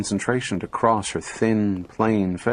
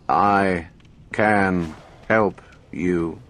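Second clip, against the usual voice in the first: slow, toneless robot voice with no intonation; flat mechanical voice; 4 semitones lower, much slower than usual. A narrator's reading. Talking robot booming its answer to Gloria, mechanical and flat. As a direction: speak like a machine, every word level.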